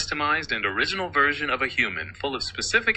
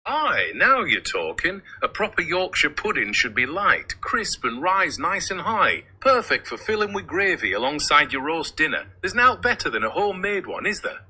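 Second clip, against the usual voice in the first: broad Yorkshire accent, unhurried; Yorkshire-accented assistant on request; 2 semitones higher than usual. Shown as a demonstration. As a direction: talk like a Yorkshire local. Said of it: "speak to me in a Yorkshire accent".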